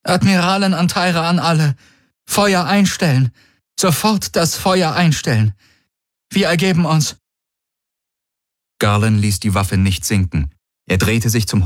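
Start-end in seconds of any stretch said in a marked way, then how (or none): 0.0-7.2 higher, strained, broken surrender order. A narrator's reading. Defeated admiral orders her fleet to cease fire, voice broken. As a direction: force out the order as the voice cracks. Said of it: "sagte sie mit gebrochener Stimme".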